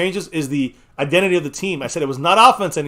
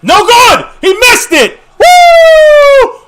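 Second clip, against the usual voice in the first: loud, high celebrating shout; excited shout; 14 dB louder, much slower than usual. Real, not acted. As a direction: yell with joy at the miss. Celebrating a missed kick.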